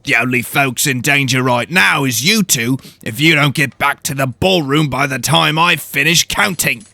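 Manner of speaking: stern house steward growling a challenge. Acted. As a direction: growl at the intruders. Voices the steward.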